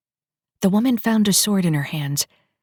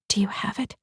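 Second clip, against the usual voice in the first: whisper; 5 semitones higher, 6 dB quieter than usual. Acted. Secret direct link talk.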